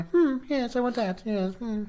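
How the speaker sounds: a spoiled queen demanding her treat; childish Red Queen. Retold or imitated. Co-host imitates the spoiled childish Red Queen, high-pitched. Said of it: "childish Red Queen".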